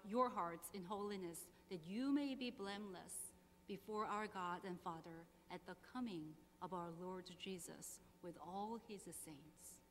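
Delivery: ordinary talk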